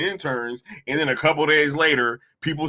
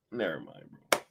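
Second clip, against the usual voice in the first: resigned whispered never mind; tired, whispered never mind; 10 semitones lower, 13 dB quieter, faster than usual. Real, not acted. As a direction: give up on it under your breath. Sighs, gives up.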